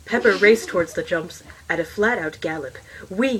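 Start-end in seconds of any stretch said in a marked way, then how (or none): 3.1-3.4 higher, joyful whoop; a pony's happy whoop; gleeful cry. A narrator's reading. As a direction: sail over the jump with glee. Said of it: "he cried".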